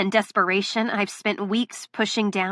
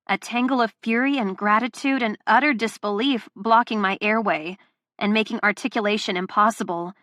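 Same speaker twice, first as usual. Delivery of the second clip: plain reading voice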